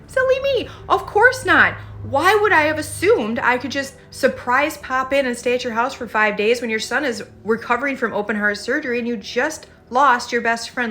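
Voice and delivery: the ideal in-law voiced as a gushing apology; mock gracious apology. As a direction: ham up a sugary, overly gracious sorry. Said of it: "a mature person would have heard that and said".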